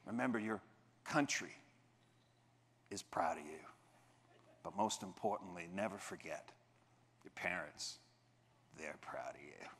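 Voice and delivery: soft and low, sinking into a whisper; fading to a whisper. Retold or imitated. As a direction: let it melt down to a whisper. Officer's emotional fading speech.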